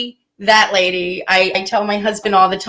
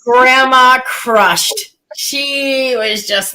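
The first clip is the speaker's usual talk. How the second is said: drawn out; much slower than usual. Real, not acted. Marveling at grandma's bluntness.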